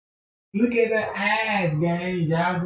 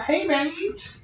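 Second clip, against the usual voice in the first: high rising hey babes; flirty mock call; 11 semitones higher than usual. He greets the women coming by, flirty. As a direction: toss it out like a pickup line. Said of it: "I'm like".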